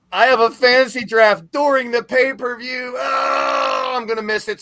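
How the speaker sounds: loud, high-pitched complaint; exasperated outburst. Real, not acted. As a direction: burst out loudly in dismay. Lamenting a clash with the pay-per-view.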